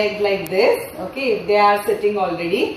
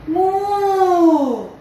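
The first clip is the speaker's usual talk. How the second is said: cow moo; 7 semitones higher, 3 dB louder than usual. Pretend. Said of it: "then say".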